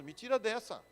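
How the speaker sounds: put-on whiny begging; mock tearful pleading. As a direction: plead to get out, lightly acting tearful. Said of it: "e fica chorando".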